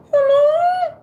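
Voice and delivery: put-on high, loud old-lady hello; granny voice. Playing her granny for the live viewers, high and loud. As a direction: call out like a granny. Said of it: "Talk up in your nose".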